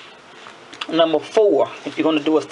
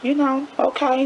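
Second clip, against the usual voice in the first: mock apology; 8 semitones higher than usual. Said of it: "And I'm like".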